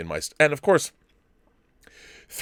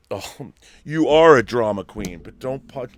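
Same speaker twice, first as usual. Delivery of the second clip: teasing doggy talk; 2 semitones higher, 6 dB louder than usual. Real, not acted. Teasing the fussy dog.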